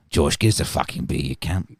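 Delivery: low, broad mock Australian; Australian accent. Pretend mate asking host for beer, low Australian accent. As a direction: play a matey Australian demanding a drink. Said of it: "in an Australian accent".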